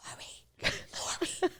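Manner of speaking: soft urgent whisper into the phone. Retold or imitated. On the phone in Oprah's bathroom, calling her assistant in a whisper. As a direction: whisper the name secretively. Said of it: "why are you whispering?".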